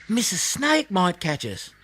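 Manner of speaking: put-on high, timid young voice; small koala pleading in fear; frightened little koala. A narrator's reading. Snubby whispering fearfully to Blinky about the snake.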